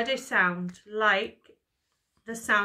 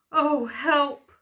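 higher, loud mock whimper; storybook lion's lightly acted fright; 5 semitones higher, 8 dB louder than usual. A narrator's reading. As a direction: cry for help, hammed up a little. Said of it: "whimpered the lion".

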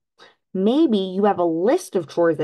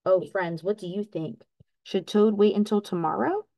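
ordinary delivery, nothing marked

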